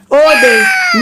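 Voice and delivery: a jolted little outcry; high exclamation. Real, not acted. Sudden cry mid-lesson.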